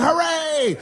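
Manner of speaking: high mock hooray; crowd cheer. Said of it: "the whole crowd said".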